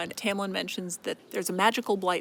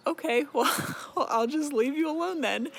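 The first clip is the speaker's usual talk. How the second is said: higher, halting with a laugh; mock awkward retreat; 7 semitones higher than usual. Tamlin rebuffed by her, backing off in mock awkwardness. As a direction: play his rebuff as a pitched-up, awkward climbdown.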